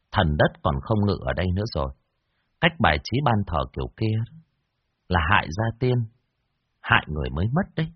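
low, grave fortune-teller voice; solemn warning, voice dropped low; stern master. Acted. Stern master warning the widow in a low, grave voice. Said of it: "nghiêm mặt".

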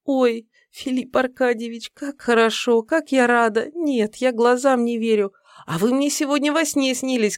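put-on high, hesitant girlish voice; high and unsure, a little breathless; unsure young woman. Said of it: "проговорила неуверенно".

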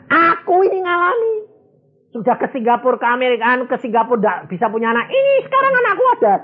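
high, fast, thrilled retelling of the miracle; excited testimony. Retold or imitated. Businessman tells the preacher of his child, high and fast. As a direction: gush about finally having a child. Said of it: "Dia bilang".

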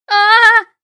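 high piercing shriek. A narrator's reading. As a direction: shriek in fright at full volume. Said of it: "заверещала я".

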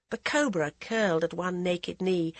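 ordinary delivery, nothing marked

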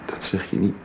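zachte fluistering; fluisterend. Acted. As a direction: fluister het vertrouwelijk. Intiem moment van vertrouwen. (zacht, fluisterend)

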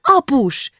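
high glad call to Dad; girl calling out. A narrator's reading. Julcsi spots her father in the library, calling out high. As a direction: cry out to Dad, high-pitched. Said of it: "kiáltott fel ekkor Julcsi".

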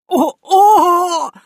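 high, loud yelping squeal. Acted. Priest whacked on his backside, high loud squeal. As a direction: squeal as the poker lands. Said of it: "взвизгнул он".

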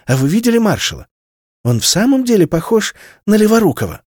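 a hint of a squeaky little girl; girl's squeak. A narrator's reading. A girl asks her mother, high and curious. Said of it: "пискнула Амалия".

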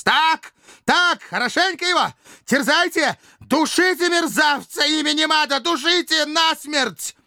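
very high, raving screams of encouragement; frenzied shouting. A narrator's reading. Raving dictator urges slaves on as they beat him, screaming. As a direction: egg them on in a mad shriek. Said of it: "продолжал кричать".

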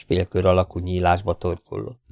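plain reading voice

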